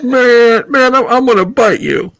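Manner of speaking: high-pitched put-on character voice; Tucker. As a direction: pitch it way up for a guessing-game impression. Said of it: "who can guess this?".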